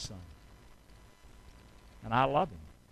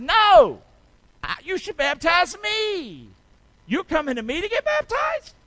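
high, far-off voice of John objecting; a startled John refusing to baptize Jesus; John protesting; 10 semitones higher, 5 dB louder than usual. Acted. Preacher voicing John as he protests to Jesus.

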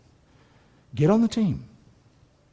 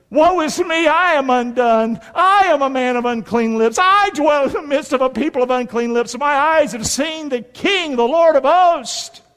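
Isaiah's lament; 8 semitones higher, 4 dB louder than usual. Retold or imitated. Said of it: "Here's what Isaiah did".

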